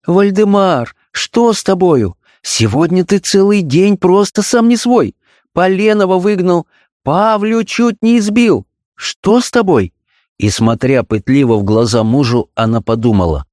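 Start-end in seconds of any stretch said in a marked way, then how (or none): 0.1-9.9 high, slow, wheedling; coaxing. A narrator's reading. Wife coaxes her troubled husband, wheedling. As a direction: sweet-talk him carefully. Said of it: "она вкрадчиво заговорила".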